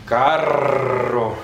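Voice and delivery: carro with a very long trill; strong rolled r. Shown as a demonstration. Teacher gives double R example, carro hugely trilled. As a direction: roll the rr in carro on and on. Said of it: "rrr fuerte".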